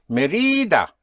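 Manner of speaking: Mérida with a long, high RI: me-REE-da; Mérida misstressed as me-RI-da, as if unaccented; wrong middle stress. Shown as a demonstration. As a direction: drag out and push the middle syllable of Mérida. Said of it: "Without the accent, it would be".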